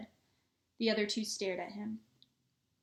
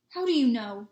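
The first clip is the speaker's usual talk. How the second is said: louder, startled sputter; 2 semitones higher, 11 dB louder than usual. A narrator's reading. Girl sputters at the professor, louder and startled. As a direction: blurt it out in disbelief. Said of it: "she sputtered".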